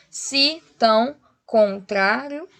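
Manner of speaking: "se... tão... con-trário", slow separate beats; counting poetic syllables. Shown as a demonstration. Teacher counting syllables likewise, slow separated beats. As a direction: break "se tão contrário" apart slowly. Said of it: "E do mesmo modo".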